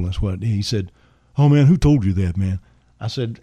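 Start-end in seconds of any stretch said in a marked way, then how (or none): 1.4-2.6 loud, raised-pitch demand. Retold or imitated. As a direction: imitate the star pressing for an answer, concerned. Elvis demands who told him.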